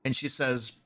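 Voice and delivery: ordinary talk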